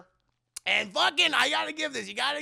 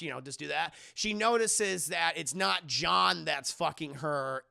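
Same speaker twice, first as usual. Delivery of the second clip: ordinary talk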